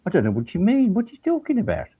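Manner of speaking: high-pitched, puzzled Brummie retort; Brummie accent. Retold or imitated. Prosecutor baffled by defense counsel's praise, high Brummie accent. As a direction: imitate a baffled Birmingham barrister, pitched well up. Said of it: "It was his actual accent".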